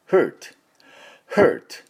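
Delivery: "hurt" as one syllable ending on a crisp t; English hurt pronunciation. Shown as a demonstration. Teacher modelling the harder similar word, English hurt pronunciation. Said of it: "同じような音で".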